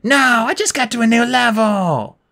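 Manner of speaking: high, drawn-out wail; shouted protest. A narrator's reading. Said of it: "as he shouted".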